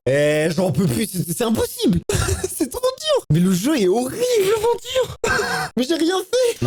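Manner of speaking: overwhelmed and pleading with the game; panicked whine. Real, not acted. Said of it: "je vous jure que j'en fais pas des caisses". (plainte paniquée, voix très aiguë)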